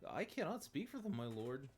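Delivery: high, loud, anxious soldier reporting; nervous underling voice. Acted. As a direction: cower as you report bad news. Underling voice again.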